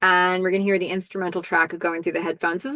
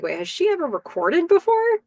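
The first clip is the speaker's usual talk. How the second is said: surprised; 10 semitones higher than usual. Retold or imitated. Band doubting newcomer.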